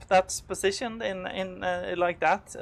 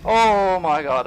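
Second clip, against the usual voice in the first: shaken relief; 9 dB louder, slower than usual. Real, not acted. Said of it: "that's so scary".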